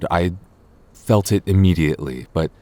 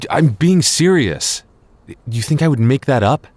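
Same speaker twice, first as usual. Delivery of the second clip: earnest insistence; 8 semitones higher, 3 dB louder than usual. Acted. Insisting he is not exaggerating.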